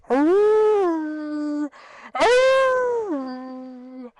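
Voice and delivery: high, loud held howls; howling along. Retold or imitated. Howling along with the dogs at the track. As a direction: howl with the dogs. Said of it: "I always howl with them".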